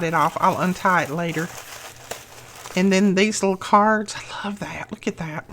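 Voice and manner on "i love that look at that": hushed whisper of admiration; whispered awe. Real, not acted. Vlogger admiring Susan's little cards, whispering. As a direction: breathe it out in wonder.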